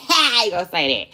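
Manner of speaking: laughing, quick. Real, not acted. Laughing to viewers, refusing to say it. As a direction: laugh through it.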